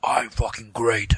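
slow, whispered self-important mutter; mock him murmuring how great he is; smug Aiden Pierce. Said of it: "Aiden Pierce was like".

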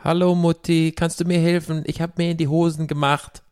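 put-on high-pitched little kid speaking German; little child. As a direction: pipe up like a small German child to mum. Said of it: "if you were little".

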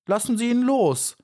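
high pleading cry; calling out. A narrator's reading. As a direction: beg her to let go. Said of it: "rief Maya".

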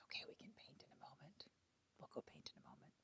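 hushed, barely audible aside; whisper. Real, not acted. Parent telling child they can paint soon, whispering.

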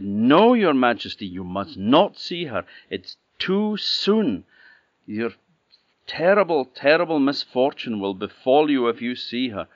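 high, loud, desperate pleading; pleading old nurse. Acted. Old nurse to the king, begging him to stop, high and frantic. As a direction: throw yourself in front of the king.

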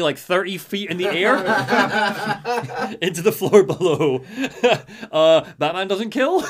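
laughing through the words; giggling as he tells it. Real, not acted. Telling friends about the fall, laughing.

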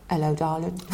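soft, hushed, lightly done imitation of Vic's accent; a quick, half-laughing go at Vic's accent. Teller greeted by the coach, Vic's accent lightly imitated. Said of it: "that's all the accent I'm gonna do with Vic".

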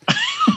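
squeaky burst of giggling; high giggle. Real, not acted. Joking about ending the call.

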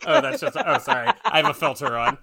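apologizing through laughter; laughing. Real, not acted. Host laughs about his video filter. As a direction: laugh while explaining.

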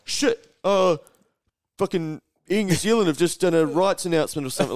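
high, loud mock panic over a trivial news alert; hammed-up alarmed phone addict; mocking news-app reader. Mocking people who panic at their news alerts, high and loud. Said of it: "And they're like".

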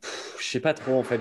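soft dismissive pff, then unsure; scornful sigh. Real, not acted. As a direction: sigh it off, unimpressed. Doubting the trade, sighs.

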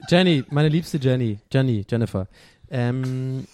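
usual speaking voice

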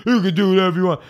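know-it-all comic. Retold or imitated. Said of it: "I used to be like that guy".

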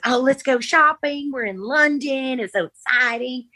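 high, bubbly imitation of an excited girl; excited Britney. Friend imitating Britney thrilled about shopping, high and bubbly. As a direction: chirp it up high like a thrilled teen. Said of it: "And she goes".

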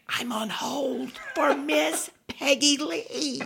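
high, drawn-out hushed squeal; giddy disbelief. Retold or imitated. Drunk fan tells his friend he is on hold, giddy squeal. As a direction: squeal it slowly under your breath, thrilled.

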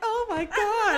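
loud, high emotional wail; re-enacted high, loud gush of emotion; overwhelmed outcry. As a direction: cry it out, overcome by it all. Retells an overwhelmed reaction to sad story.

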